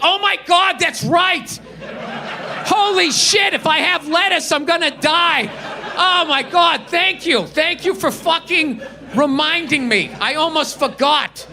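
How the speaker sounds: sarcastic thanks to the waiter, hammed-up; sarcastic fake gratitude. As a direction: overplay the relief sarcastically. Sarcastic reply about allergies.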